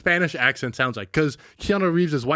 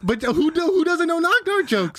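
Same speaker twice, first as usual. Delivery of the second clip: incredulous; 13 semitones higher, slower than usual. Real, not acted. Incredulous at cohost.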